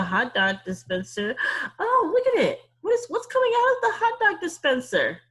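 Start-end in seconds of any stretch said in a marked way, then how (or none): 1.8-5.2 much higher and louder, laughing; thrilled discovery for the kids; excited. Acted. Teacher excited at picture.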